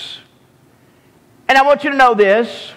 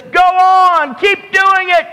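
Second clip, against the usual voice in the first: mocking shout; 9 semitones higher than usual. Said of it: "he is mocking them and says".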